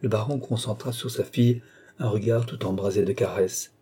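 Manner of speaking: ordinary delivery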